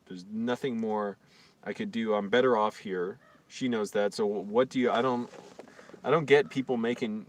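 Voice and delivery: normal talking voice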